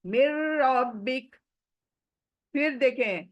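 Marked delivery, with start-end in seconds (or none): 0.0-1.4 मिर रब्बिक ending on a silent क; half stop. Shown as a demonstration. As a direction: cut मिर रब्बिक short on the qaf. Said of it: "इसलिए half का होगा".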